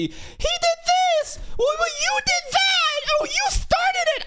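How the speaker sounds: very high, squeaky mock bickering; hammed-up high school drama squabble; mock squabbling kids. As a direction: squeal it like a petty schoolkid fight. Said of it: "it's literally".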